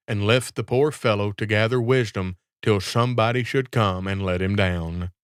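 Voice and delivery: ordinary delivery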